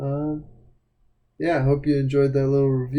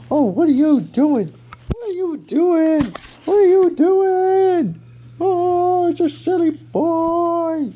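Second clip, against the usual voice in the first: high, sing-song praise for the dog, much higher and louder; doggy baby talk; 9 semitones higher, 8 dB louder, slower than usual. Acted. Owner gushing at his service dog, high baby talk. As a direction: coo and gush over a good boy.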